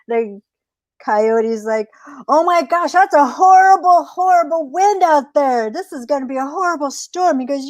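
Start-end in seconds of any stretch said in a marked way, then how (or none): 2.3-7.4 high, worried storybook coyote; alarmed coyote. Acted. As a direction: exclaim in hammed-up dismay.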